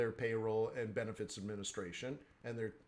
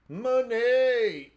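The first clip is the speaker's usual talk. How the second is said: excited shout; 9 semitones higher, 9 dB louder than usual. Real, not acted. Bursts out after beat.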